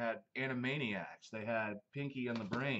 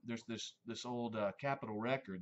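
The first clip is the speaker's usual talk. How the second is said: ordinary talk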